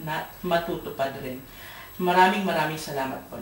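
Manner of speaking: murmured plea to the Lord; earnest prayer. Acted. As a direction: pray quietly and humbly to God. Praying before job hunting.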